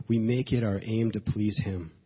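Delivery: ordinary talk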